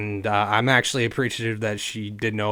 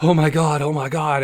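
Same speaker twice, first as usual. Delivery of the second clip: re-enacted panicky gasping, raised and louder; imitated heavy-breathing panic; breathing heavily, freaking out; 4 semitones higher, 5 dB louder than usual. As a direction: gasp it out as if panicking. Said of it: "I'm breathing heavily".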